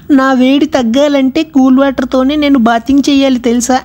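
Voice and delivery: high coy flirting voice; flirty heroine voice. Retold or imitated. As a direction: tease him in a coy, high voice.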